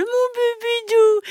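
put-on very high cooing baby talk; squeaky-high doting dog-owner voice; silly pet-talk voice. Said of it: "je lui parle vraiment de façon stupide".

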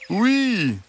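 high, slow, sweet fox voice; gentle, reassuring fox. A narrator's reading. Fox asks the hens to let him in, gentle and reassuring. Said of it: "répondit-il".

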